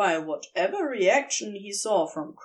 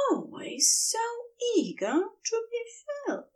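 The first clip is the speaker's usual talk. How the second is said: soothing tones; 12 semitones higher, 4 dB quieter than usual. A narrator's reading. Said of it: "in his most soothing tones".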